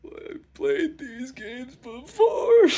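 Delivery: very slow, loud, high drawn-out wail; fed-up cry about dating games; exasperated outburst. Real, not acted.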